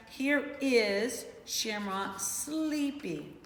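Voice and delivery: very slow, drawn-out read; slow sleepy reading. Shown as a demonstration. Teacher rereads the page to her class, very slowly. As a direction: stretch each word like you're nodding off. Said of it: "I'm sleepy".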